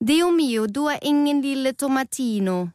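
a dramatic Italian tomato gasping; tomato mocking Rød, high Italian voice. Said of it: "da en tomat sagde".

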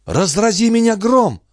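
put-on high, shocked old woman cry; gasped exclamation. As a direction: gasp it out in shock. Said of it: "ахнула миссис Ситвуд".

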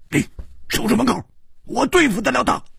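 high, urgent shout, fast at the end; barked order. A narrator's reading. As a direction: call it out as a sharp command. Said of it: "喝道". (厉声喝道)

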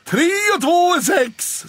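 high, loud sing-song mockery; high jeering voice; teasing cat Bill. A narrator's reading. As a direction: gang up on Pelle with sums. Bill teases Pelle.

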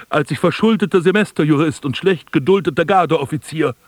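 ordinary delivery

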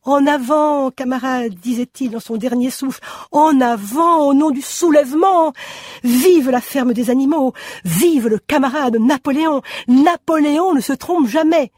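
lightly voiced last rallying call; rallying cry. A narrator's reading. Quoting dying rallying words. (slogans exaltés, plus aigus)

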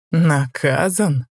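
slow mocking echo of the word; scornful. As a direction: sneer the word back slowly. Lucifer repeats scornfully.

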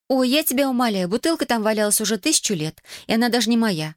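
put-on high-pitched, exasperated teenage protest; stubborn. A narrator's reading. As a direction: stand your ground in a high girl's voice. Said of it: "упорствовала Эмили". (упрямо, высоким голосом)

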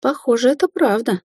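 high-pitched, wondering realisation; amazed. A narrator's reading. Sorceress realising her captive told the truth, amazed. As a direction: lift the pitch in astonishment. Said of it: "изумлённо покачала головой Рубин".

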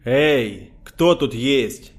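slow drawn-out call into the empty corridor. A narrator's reading. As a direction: call loudly down the hall. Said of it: "и вдруг крикнул".

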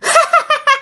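high-pitched sinister laugh; mocking witch-like laugh; ghost hag cackle. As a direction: cackle over cornered prey. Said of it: "Bà nói rằng".

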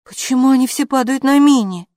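loud, high puzzled question; amazed, voice lifted; surprised. A narrator's reading. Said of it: "удивилась Хилла".

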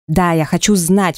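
plain reading voice

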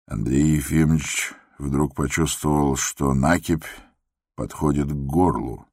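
ordinary delivery, nothing marked

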